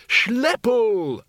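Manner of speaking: high-pitched call for help; shout. A narrator's reading. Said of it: "shouted Windle".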